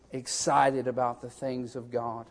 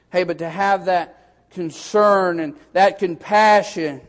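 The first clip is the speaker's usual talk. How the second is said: louder and higher, driving sermon cadence; full-force preaching; 6 semitones higher, 8 dB louder than usual. Acted. Pastor urging the congregation to warn others, preaching hard. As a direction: hammer each Hey home to the pews.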